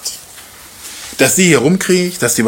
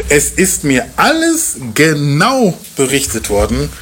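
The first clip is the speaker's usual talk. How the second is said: solemn scripture reading. Acted. Reads scripture slowly and solemnly.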